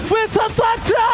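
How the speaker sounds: shouted high play call; hype shout. Real, not acted. Caster hypes a big play.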